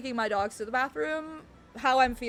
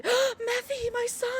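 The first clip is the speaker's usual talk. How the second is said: gasp, then a much higher delighted call; surprised greeting; 12 semitones higher, slower than usual. Real, not acted. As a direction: greet him with a gasp, high. Gasps at chat member.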